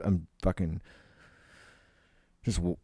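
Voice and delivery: ordinary delivery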